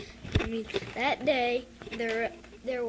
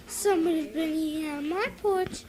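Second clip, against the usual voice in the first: Mother Bear; 5 semitones higher, 5 dB louder than usual. Acted.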